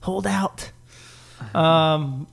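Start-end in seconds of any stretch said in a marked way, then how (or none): 0.0-0.7 hushed, lightly acted coaxing, not a full whisper; mock belly whisper. Dad coaxing his unborn baby to wait, mock whisper. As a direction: softly imitate a hushed plea. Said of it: "whispering in the belly at night".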